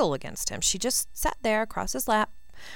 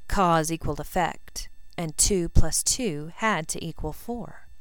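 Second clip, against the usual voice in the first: ordinary delivery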